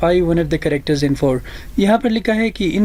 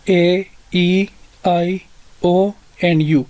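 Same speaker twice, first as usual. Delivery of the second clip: listing vowels slowly; much slower than usual. Shown as a demonstration. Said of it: "five vowels ہے".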